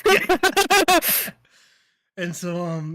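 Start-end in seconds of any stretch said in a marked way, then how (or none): 0.4-1.3 loud high laugh. Real, not acted. Laughing at tequila joke.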